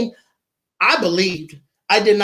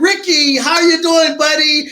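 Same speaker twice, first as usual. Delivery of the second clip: higher, louder, bright with certainty; confident upbeat greeting; 7 semitones higher, 5 dB louder than usual. Retold or imitated. Lawyer calling her client with good news, upbeat and sure. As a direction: greet him like you already know he's won. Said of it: "hers sound a little bit more sure".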